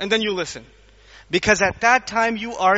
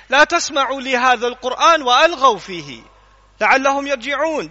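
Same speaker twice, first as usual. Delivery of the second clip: Quran recitation; 2 semitones higher, much faster than usual. Shown as a demonstration. Quran recitation quoted in lecture.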